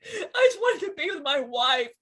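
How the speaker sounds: upset, voice pushed up high; distraught protest. Real, not acted. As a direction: protest through rising grief. Upset outburst over losing his wife.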